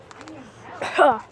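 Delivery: real cough after swallowing a bug. Real, not acted. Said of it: "just hopped in my mouth".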